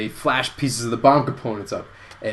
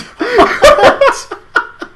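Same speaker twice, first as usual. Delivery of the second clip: high, loud burst of laughter; high-pitched cackle of amusement; laughing; 8 dB louder than usual. Real, not acted. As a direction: crack up at your own joke. Laughs at own cheesy ending.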